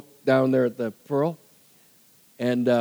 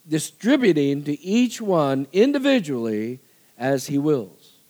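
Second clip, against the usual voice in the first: measured, lifted reading voice with each phrase set apart; scripture reading; 4 semitones higher than usual. Acted. Preacher reading First Corinthians to the congregation, measured and lifted. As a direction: read the verses out as if from the pulpit. Reads scripture passage aloud.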